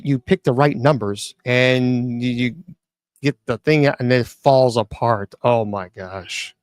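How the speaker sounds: ordinary delivery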